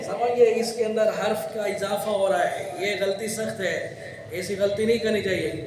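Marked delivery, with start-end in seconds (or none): none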